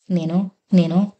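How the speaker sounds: slow, halting nervous stammer; a wife caught off guard, stammering slowly; stammering hesitation. Acted. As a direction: stall on each word, lost for a reply. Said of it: "ఏంటా నానప్పుడు నేను నేనంటూ?".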